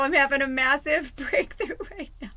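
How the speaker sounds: high and loud, laughing through it; laughing excitedly. Real, not acted. Repeating her breakthrough to viewers, laughing. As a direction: giggle mid-sentence, elated.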